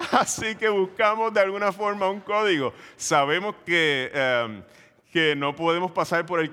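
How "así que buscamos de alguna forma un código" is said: laughing. Real, not acted. Funny family code story.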